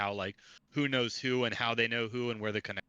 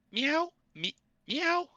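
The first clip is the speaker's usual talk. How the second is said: squeaky high-pitched cat meow; higher pitch voice; much slower than usual. Shown as a demonstration. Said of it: "my best effort higher voice".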